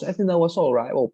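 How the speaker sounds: ordinary delivery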